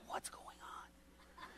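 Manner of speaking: slow, hushed mock-worried whisper; very soft, drawn-out puzzlement; whispered aside. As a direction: whisper it as a nervous thought. Inner question while being stared at.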